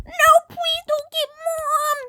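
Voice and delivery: high, loud pleading; desperate plea. Real, not acted. Sister begging not to get Mom, desperate plea. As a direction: beg her not to fetch mom.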